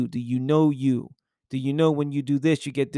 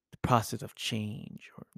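hushed, intense whisper; soft urgent whisper; 4 semitones lower, 6 dB quieter than usual. Real, not acted. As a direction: lean in and whisper it. Whispered emphasis.

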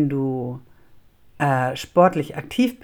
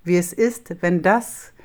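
usual speaking voice

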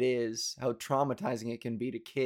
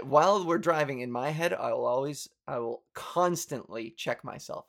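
ordinary talk, nothing marked

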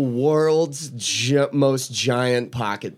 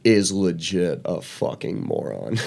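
ordinary talk

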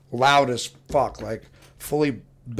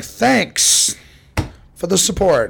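sarcastic thanks; 5 semitones higher, 4 dB louder, much slower than usual. Retold or imitated. Sarcastic reply.

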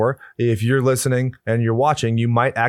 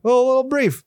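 squeaky; 13 semitones higher, faster than usual. Pretend. High squeaky quip.

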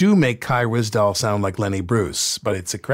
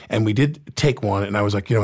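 normal talking voice

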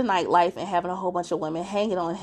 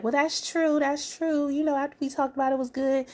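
higher, light agreeing voice; mock Monique conceding; 8 semitones higher than usual. Retelling Monique agreeing with Chris, a light high voice. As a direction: play her backing down.

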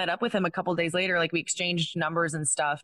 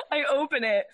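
laughing; 13 semitones higher than usual. Real, not acted. Retelling the stalker's message.

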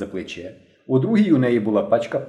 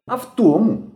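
high, emphatic stern reply; strict young leader's retort; 10 semitones higher, 4 dB louder than usual. A narrator's reading. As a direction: cut back at them severely. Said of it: "суворо сказала дівчина".

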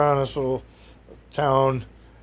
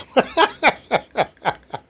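laughs; 10 semitones higher than usual. Real, not acted. Laughing at own story.

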